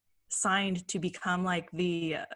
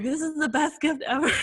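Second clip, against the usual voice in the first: put-on high, louder, overjoyed kid gushing; hammed-up childlike delight; so happy; 9 semitones higher, 5 dB louder than usual. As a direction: gush over a treasured present, laughing. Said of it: "so happy about this damn cup".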